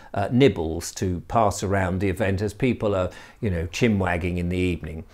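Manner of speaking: usual speaking voice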